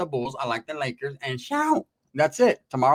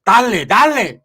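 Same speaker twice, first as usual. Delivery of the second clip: high, loud hyped yelling during a brawl; egging the homies on mid-fight; old school fight yelling; 8 semitones higher, 7 dB louder, slower than usual. Retold or imitated. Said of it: "giving them the old school stizompazization".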